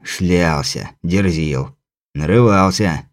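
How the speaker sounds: low, soft, sullen leprechaun; reluctant low grumble. Acted. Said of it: "угрюмо ... нехотя сообщил".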